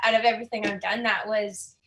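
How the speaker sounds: ordinary delivery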